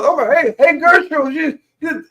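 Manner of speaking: be a hen calling her friend; chicken gossip voice. Pretend. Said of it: "They go".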